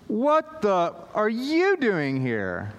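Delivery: hammed-up baffled kid; stunned teen. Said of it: "said it over and over".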